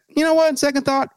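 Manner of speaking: mocking the Republicans backing out in a high voice. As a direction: toss it off like a flippant change of heart. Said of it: "the Republicans just went".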